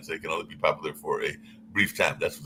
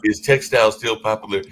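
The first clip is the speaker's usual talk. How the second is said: louder, clearer repeat; 3 semitones higher, 7 dB louder than usual. Real, not acted. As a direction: repeat it louder for a bad line. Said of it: "your voice is doing something weird".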